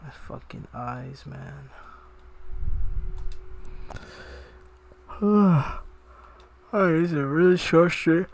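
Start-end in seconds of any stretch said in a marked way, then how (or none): 0.0-1.7 slow, quiet, worn-out groan; bleary-eyed and running on empty; exhausted. Real, not acted. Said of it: "My eyes are so watery". 1.7-6.7 long weary sigh. Real, not acted. Tired streamer, long stream.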